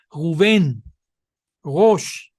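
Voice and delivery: Rubén and Rosh with a throaty French R at the start; French-style R. Shown as a demonstration. Teacher shows the Israeli-born French R. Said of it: "así la pronuncian los judíos nacidos en Israel".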